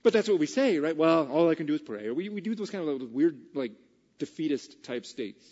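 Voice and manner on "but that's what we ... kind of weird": loud, high, emphatic preaching; full-force preaching. Real, not acted. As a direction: preach it to the whole room.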